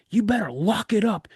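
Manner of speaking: in-your-face drill sergeant warning; stern warning. Retold or imitated. Instructor in the recruit's face, warning him sternly. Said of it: "he's like".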